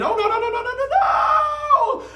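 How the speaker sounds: rising frantic cry of no; panicked shout. A narrator's reading. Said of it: "the sound of her brother saying".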